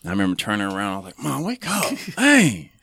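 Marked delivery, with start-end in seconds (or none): 1.2-2.7 high, urgent plea to wake mom; exasperated son rousing his snoring mom; frantic wake-up call. Retold or imitated. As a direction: shake her awake with your voice, much higher. Waking snoring mom, retold.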